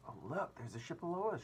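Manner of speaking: high, excited call; excited shout. A narrator's reading. James spotting a ship below, excited high call. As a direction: point it out, thrilled. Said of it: "shouted James".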